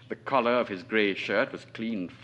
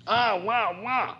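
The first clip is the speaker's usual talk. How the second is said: mock bawling; 12 semitones higher, slower than usual. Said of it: "You would hear them bawling".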